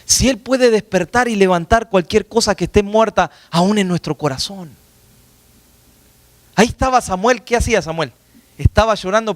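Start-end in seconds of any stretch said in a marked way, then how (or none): none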